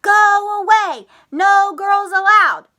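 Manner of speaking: high, loud mock shout; Froggy's rude words. Teacher quoting Froggy's rude shout, mock loud. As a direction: quote him rudely. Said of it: "he could have said besides".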